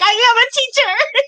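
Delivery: very high, squeaky self-imitation; squeaky retold reply. Preschool owners' child answering a caller who wants a teacher, squeaky retold reply. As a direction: mimic yourself squeaking indignantly on the phone. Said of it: "And I'm like".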